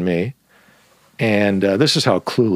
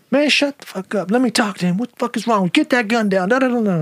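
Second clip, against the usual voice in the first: put-on much higher, bossy girl voice; young woman; 11 semitones higher than usual. Teller voicing the girl telling the gunman to stop. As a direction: take charge like a tough young woman. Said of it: "and she's like".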